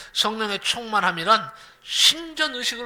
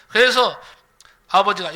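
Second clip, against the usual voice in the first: ordinary talk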